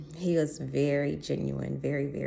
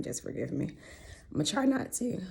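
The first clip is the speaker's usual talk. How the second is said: holding back tears; 9 semitones higher than usual. Real, not acted. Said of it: "if I start crying".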